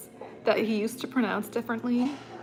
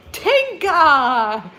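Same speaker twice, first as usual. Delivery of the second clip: loud, higher, bright announcer call; hyped DJ call, much louder; excited and happy; 6 semitones higher, 11 dB louder than usual. Retold or imitated. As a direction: announce the name like it's the best news. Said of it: "so excited and happy".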